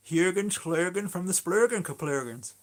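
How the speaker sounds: high, loud comic film-character voice; hammed-up imitation of a comedy film line; Mike Myers film quote. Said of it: "Austin Powers when he goes".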